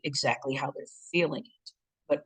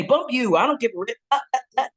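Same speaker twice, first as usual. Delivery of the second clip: louder, higher mock outburst; aggressive retort; 8 semitones higher, 6 dB louder than usual. Shown as a demonstration. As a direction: snap back like you're going off. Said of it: "that's not assertive. That's aggressive communication".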